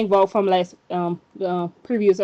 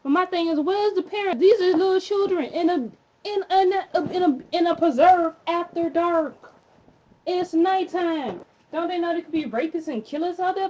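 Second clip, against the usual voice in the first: higher-pitched, exasperated questioning; indignant; 8 semitones higher than usual. Real, not acted. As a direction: demand answers from careless parents. Indignant rant at the parents.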